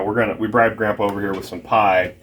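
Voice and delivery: usual speaking voice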